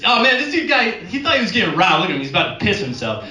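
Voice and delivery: high, teasing guard voice; guard laughing at the scared tourist; mocking security guard. Guard mocks the tourist to his colleague. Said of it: "He goes".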